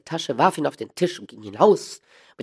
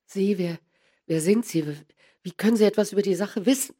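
nervous stammer; 8 semitones higher than usual. A narrator's reading. Startled man questioning the stranger.

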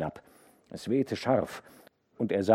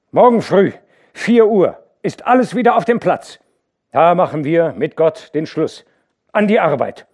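loud, high firm instructions; commanding orders; 9 semitones higher, 11 dB louder than usual. A narrator's reading. Hauke ordering the overseers for tomorrow. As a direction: give the orders with authority.

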